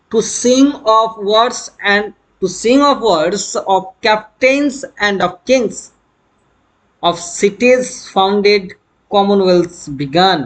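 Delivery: slow, measured verse recital; deliberate classroom poetry reading; poem reading. Acted. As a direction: read the verse out word by word, very slowly. Reads the poem's opening aloud.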